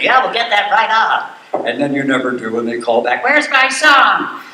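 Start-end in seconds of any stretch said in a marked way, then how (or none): 0.0-1.7 high, drawn-out phone promise; hammed-up empty reassurance to a caller; fake eager radio host. Acting out phone answer to requests. 3.2-4.4 high, loud, drawn-out demand; indignant caller imitation. Acting out caller calling back.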